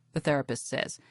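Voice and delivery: usual speaking voice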